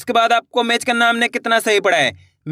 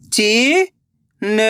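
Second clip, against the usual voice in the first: very slow, syllables drawn out; slow syllable reading; much slower than usual. Shown as a demonstration. Teacher sounding out a word for the class, very slowly. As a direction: spell it out slowly. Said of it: "तो मिलके बन जाएगा".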